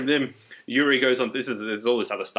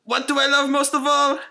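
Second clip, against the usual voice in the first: high, loud hammy Russian; exaggerated Russian exclaiming; Russian accent; 4 dB louder than usual. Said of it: "And then".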